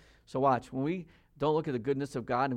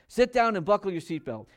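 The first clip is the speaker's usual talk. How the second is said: louder, higher parental command; lightly voiced insistent dad giving an order; stern father; 6 semitones higher, 5 dB louder than usual. Father orders strong-willed child in car, mildly stern command.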